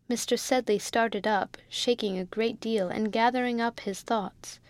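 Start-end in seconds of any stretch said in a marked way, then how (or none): none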